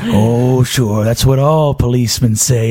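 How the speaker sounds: 1930s gangster. Acted. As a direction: threaten like an old movie mobster. Gangster mocks the fireman.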